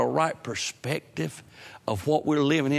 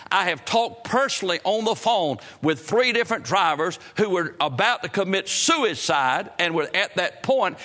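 raised, driving preacher's cadence; full-force preaching; 7 semitones higher than usual. Acted. Preacher urging the congregation toward evangelism, fervent and raised. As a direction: preach it out with rising fervor.

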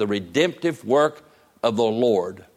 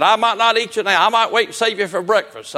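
higher, louder sly fox; teasing fox; 7 semitones higher, 3 dB louder than usual. Retold or imitated. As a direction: toy with your prey. Fox toys with rabbit.

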